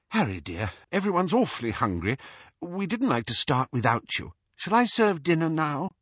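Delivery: gentle, hesitant request, lifting in pitch; tentative. A narrator's reading. Mother asks Harry about serving dinner, tentative. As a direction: ask carefully, without pressing. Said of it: "Missus Weasley said tentatively".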